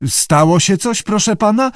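high, unsure question; mildly nervous keeper; uncertain. A narrator's reading. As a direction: falter upward, fearing trouble. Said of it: "wyjąkał Grex niepewnie".

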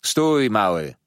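loud commanding halt; called out. A narrator's reading. Older pupil leaving his bench to stop the boy, calling out. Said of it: "zawołał, wychodząc z ławki".

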